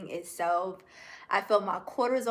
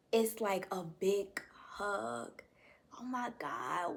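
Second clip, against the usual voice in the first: whispered through real tears; a real whisper as the tears come; tearful whisper; 8 dB quieter than usual. Real, not acted. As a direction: breathe it out, overcome, barely voiced. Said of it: "why am I crying".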